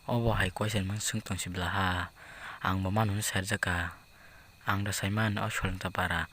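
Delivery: low, slow, very soft murmur; hushed, slow private musing; murmured aside. Acted. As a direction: drag it out quietly, as if thinking to yourself.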